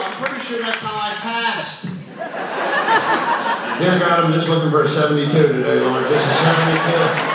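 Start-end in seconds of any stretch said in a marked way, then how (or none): 2.2-7.3 loud pleading prayer; desperate student prayer. Retold or imitated. Schoolboy praying for a seventy-two on test, loud pleading. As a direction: beg God loudly for a passing grade.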